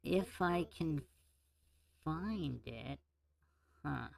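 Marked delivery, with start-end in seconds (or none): none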